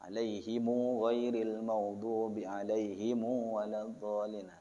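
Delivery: slow Quran recitation with "alaihimu" ending in a long u; measured chant holding the "mu" of "alaihimu"; Qalun-style recitation. Retold or imitated. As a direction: recite it slowly, drawing out each "alaihimu". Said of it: "hataman baca".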